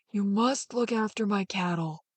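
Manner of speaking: put-on the old drover crawling into the sack, slow and soft. A narrator's reading. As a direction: murmur it slowly like a frail old man.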